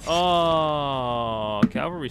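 loud drawn-out oh; thrilled at finding the sword; loud delighted exclamation; excited oh. Real, not acted. Finds a cavalry sword.

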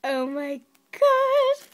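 very high, loud, slow squeal; drawn-out squeal. Real, not acted. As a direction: stretch it out in a thrilled squeal. Gushing over squishy.

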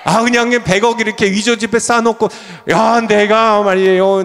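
high, loud pulpit exhortation; impassioned high-pitched sermon delivery; full-force preaching. Real, not acted.

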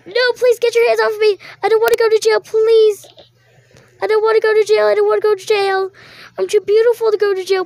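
high, loud, fast begging; pleading prisoner. Pretend. Accused girl begs the police not to jail her, frantic pleading. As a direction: beg like you're being dragged off.

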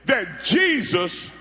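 ordinary talk, nothing marked

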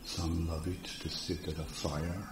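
soft, unhurried trance-induction voice; guided meditation. Real, not acted. Hypnotherapist guiding a seated group into inner orientation, soft guided meditation. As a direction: ease them inward softly, leaving long pauses. Leads a guided relaxation exercise.

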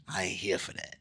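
whispered aside under the breath. Real, not acted. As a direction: drop to a whisper, just for yourself.

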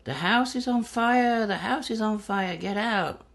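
high, slow shouted warning; retold yelling. Stranger shouting a fire warning at dawn, loud high yell. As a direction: yell it like a neighbour raising the alarm. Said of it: "heard this voice yelling".

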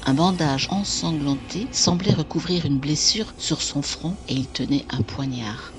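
ordinary delivery, nothing marked

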